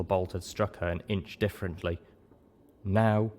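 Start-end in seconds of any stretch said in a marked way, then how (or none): 2.8-3.3 slow, lightly voiced bossy mare; a hint of a prim nurse giving orders, drawn out slowly; gentle bossiness; ordering. A narrator's reading. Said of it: "Velvet ordered the zebra mare".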